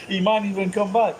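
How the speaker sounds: usual speaking voice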